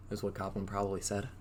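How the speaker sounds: usual speaking voice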